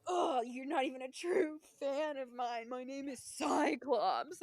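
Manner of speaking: mock his huffy, drawn-out indignation; sassy. Offended park character correcting fans on his name, sassy. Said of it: "He, like, got all sassy with us".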